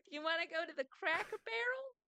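mock Southern accent. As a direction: play a cheery Southern American. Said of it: "make fun of the U S".

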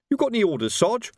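anxious question to the sarge; nervous constable asking for orders; Fred Colon's voice. A narrator's reading. Fred Colon asks Vimes.

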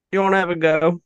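a half-hearted Aussie challenge; bad Australian accent. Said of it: "my horrible impersonations".

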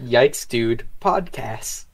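each word drawn out; slow and deliberate. Acted. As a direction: say the name very slowly. Repeating the name slowly.

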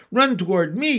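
high, urgent call; yell. A narrator's reading. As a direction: yell it across a yard. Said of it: "yelled the gander".

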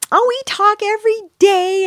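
very high, gushing imitation; mock lovestruck woman. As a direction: put on her high lovey-dovey voice. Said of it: "And she goes".

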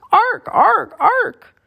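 high, loud raven squawks; three piercing bird cries; raven caws. A narrator's reading. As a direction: caw like a dive-bombing raven. Voicing raven caws in a dive attack.

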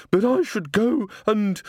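high, slowed pleading; anxious old woman. A narrator's reading. Missus Plinge anxiously tries to excuse herself, high. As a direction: try to slip away.

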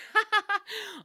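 short high burst of laughter; sharp laugh. Real, not acted. Laughs at own joke.

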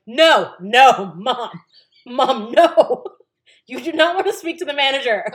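loud, high, alarmed plea; urgent plea. Retold or imitated. Daughter stopping her mom from calling the manager, loud and high. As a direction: stop her before she complains.

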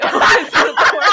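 laughing. A narrator's reading. Narrating, laughing.

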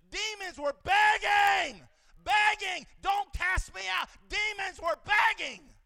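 full-force hammered preacher's cry; preaching shout. Acted. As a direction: shout it out from the pulpit. Preacher shouting about demons.

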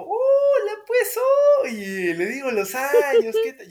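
very high, loud, over-the-top tipsy hello with a laugh; drunk greeting. Retold or imitated. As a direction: greet him like a wasted old friend at your own party. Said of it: "pero ebrio mal".

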